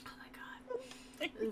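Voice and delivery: breathy stunned whisper. Real, not acted. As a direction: whisper it in disbelief. GM overwhelmed by cuteness.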